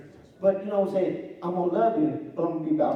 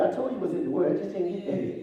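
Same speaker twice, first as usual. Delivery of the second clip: ordinary talk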